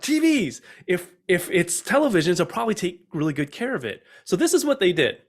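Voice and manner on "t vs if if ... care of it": excited. Retold or imitated. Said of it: "So like".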